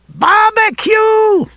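high, slow manic screaming; cackling hell torturer. A narrator's reading. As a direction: scream it with crazed glee. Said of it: "in his screaming, laughing voice".

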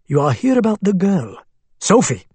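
put-on Arabic accent. A narrator's reading. Abu Hamad's voice.

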